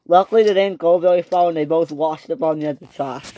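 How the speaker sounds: ordinary delivery, nothing marked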